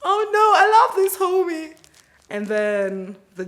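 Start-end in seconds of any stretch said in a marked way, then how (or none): 0.0-1.8 very high, loud sugary plea; wife pleading to the judge to stay married; wife's mock protest. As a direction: gush it like a hammed-up devoted wife. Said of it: "Sis is like".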